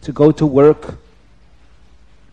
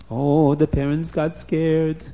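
plain reading voice

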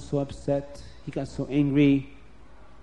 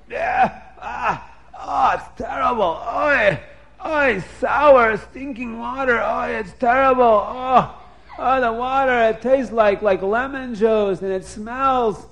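higher, louder, hammed-up disgust at the taste; disgusted Lavan; 7 semitones higher, 5 dB louder than usual. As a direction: spit it out like sour water. Lavan tastes the sour water.

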